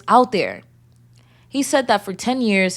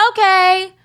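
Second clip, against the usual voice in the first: high, louder naive okay; high mock okay; 11 semitones higher, 5 dB louder than usual. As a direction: play your younger, dazzled self. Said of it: "I said".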